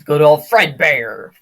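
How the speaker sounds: loud, drawn-out name call; hammed-up announcing. Player presenting the night six enemy, loud hammed-up call. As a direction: bellow the name like a mock announcer. Hyped naming of game character.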